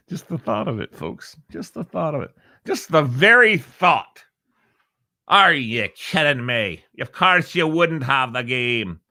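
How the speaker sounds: lilting mock Irish accent; Irishman's take. Radio host gives an Irishman's take on the game, mock Irish accent. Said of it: "that's an Irishman's take on it".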